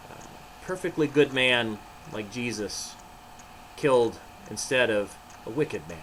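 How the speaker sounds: ordinary delivery, nothing marked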